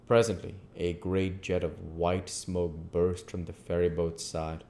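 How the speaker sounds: ordinary delivery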